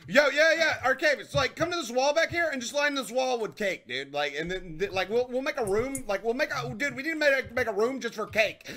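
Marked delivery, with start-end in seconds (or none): none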